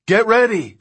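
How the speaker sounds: high, loud urgent shout; shouted order. A narrator's reading. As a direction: shout the cue over engine noise. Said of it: "she shouted".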